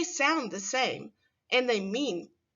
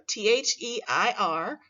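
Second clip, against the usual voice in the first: ordinary talk, nothing marked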